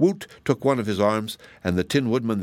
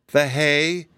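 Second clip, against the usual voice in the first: higher-pitched, drawn-out plea; pleading; 5 semitones higher, 3 dB louder than usual. A narrator's reading. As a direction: beg for it in a raised voice. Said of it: "pleaded the Scarecrow's head".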